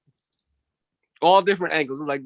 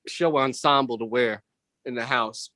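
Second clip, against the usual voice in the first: ordinary delivery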